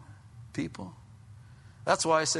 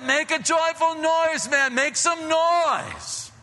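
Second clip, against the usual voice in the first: high, drawn-out rallying cry; rousing exhortation; 8 semitones higher, slower than usual. Real, not acted. Preacher urging the congregation to celebrate, high and rousing. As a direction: stretch the last word to rouse them.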